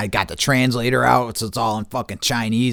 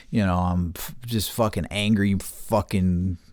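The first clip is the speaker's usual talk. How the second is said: ordinary delivery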